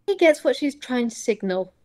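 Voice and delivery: ordinary talk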